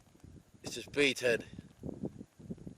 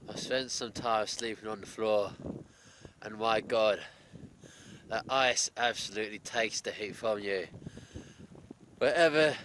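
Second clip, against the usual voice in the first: usual speaking voice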